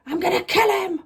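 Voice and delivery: high-pitched retold outburst; hammed-up exasperated threat; mock fury. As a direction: squeal it in mock rage. Retold frustration at unreachable boyfriend.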